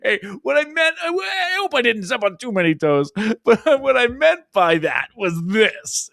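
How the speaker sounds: high, laughing mock apology; mock sheepish CEO. Host imitates the CEO backpedaling on a show, high and laughing. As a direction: play the nervous backpedaling boss. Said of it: "be like".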